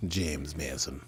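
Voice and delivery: a hint of old Hollywood English; James Mason impression. Showing the co-host the actor's name, lightly mimicked English voice. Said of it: "my bad James Mason accent".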